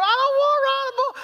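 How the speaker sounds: hammed-up falsetto scream; screaming child. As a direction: imitate a boy wailing in protest. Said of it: "starts screaming the closer we get".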